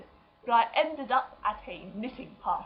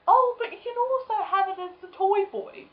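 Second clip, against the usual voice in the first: put-on chirpy old lady; 10 semitones higher, 4 dB louder than usual. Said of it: "And then one went".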